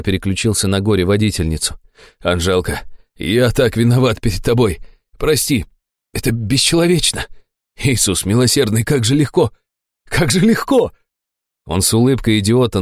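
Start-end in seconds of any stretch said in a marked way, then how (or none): 2.2-10.9 repentance rising to elation; emotional repentant fat man. A narrator's reading. Fat man begs Anzhelka's forgiveness, emotional.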